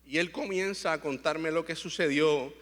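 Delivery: ordinary delivery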